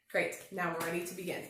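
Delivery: usual speaking voice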